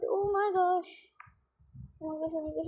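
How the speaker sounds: ordinary talk, nothing marked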